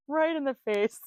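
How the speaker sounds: normal talking voice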